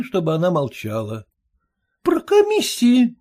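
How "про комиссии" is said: exclaiming. A narrator's reading. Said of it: "воскликнула дочь".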